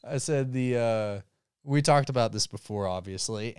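normal talking voice

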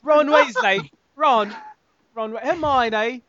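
high-pitched, hammed-up British accent; mock British accent. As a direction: call the names in a put-on British voice. Mimicking British Harry Potter characters.

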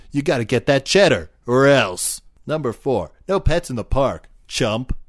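brash chess hustler ad read; cocky ad voice. Acted. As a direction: sell it like a tough-guy pitchman. Chester ad read in character.

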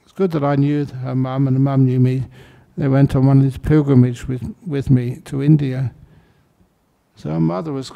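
normal talking voice

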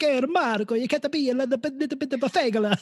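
high, hammed-up Italian accent; Italian dad voice, teasing his son; mock Italian dad. Podcast host quoting the Italian dad, in a hammed-up Italian accent. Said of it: "Like that, that's what he says".

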